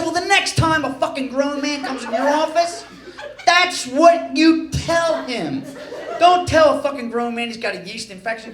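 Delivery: lecturing the doctor, hammed-up fury; comic angry tirade; retold rant.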